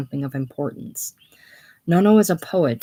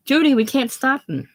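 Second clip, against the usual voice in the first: put-on girl's voice from below; 8 semitones higher, 3 dB louder than usual. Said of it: "Girl's voice from below".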